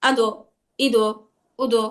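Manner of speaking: "আদো, ইদো, উদো" with a push on each দ; natural first vowel, then bounce the দ in "আদো, ইদো, উদো"; pushed, doubled dal. Shown as a demonstration. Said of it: "দালে একটা ধাক্কা দিয়ে দুইবার উচ্চারণ". ("আদো, ইদো, উদো"-তে দালে ধাক্কা দিয়ে দ্বিত্ব)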